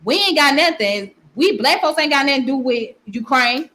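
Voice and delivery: ordinary delivery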